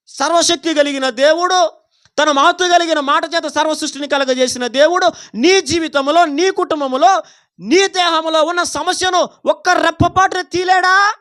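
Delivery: high, forceful preaching. Real, not acted. Proclaims God's power. (గొంతు పెంచి నిలదీస్తూ ప్రసంగం)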